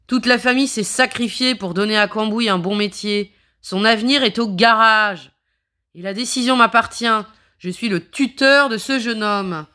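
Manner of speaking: loud, stern guardian laying down the law. Acted. Ross claims authority.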